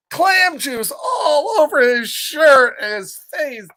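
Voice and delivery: high, slow, half-laughing cry of dismay; dramatic dismay. A narrator's reading. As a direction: wail it like a comic disaster. Mishap narrated dramatically, laugh.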